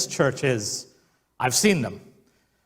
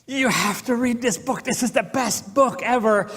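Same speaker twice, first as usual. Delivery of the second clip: high, gushing book recommendation; extreme excitement; 9 semitones higher than usual. Retold or imitated. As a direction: sell the book like a thrilled friend. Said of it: "extreme excitement".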